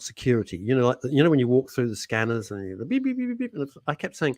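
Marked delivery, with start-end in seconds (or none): 2.8-3.5 imitated scanner beeps.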